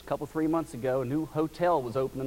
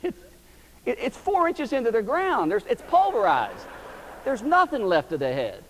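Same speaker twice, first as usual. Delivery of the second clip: amazed exclaiming; 8 semitones higher, 4 dB louder than usual. Retold or imitated. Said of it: "I said".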